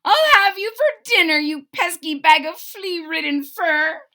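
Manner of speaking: high, loud angry threat; leprechaun yelling. A narrator's reading. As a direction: bellow threats in a tiny high voice. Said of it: "the little man yelled".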